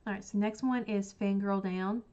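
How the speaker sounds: normal talking voice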